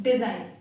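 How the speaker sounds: "डिजाइन" loud, di-zine with no g; teacher stresses "डिजाइन" without a g; silent g. Shown as a demonstration. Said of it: "जी है वो हमारा silent".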